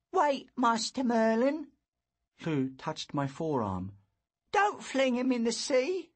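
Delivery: put-on high urgent boy; a boy begging mercy; pleading boy. A narrator's reading.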